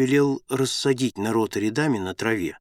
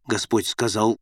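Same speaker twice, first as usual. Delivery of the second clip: plain reading voice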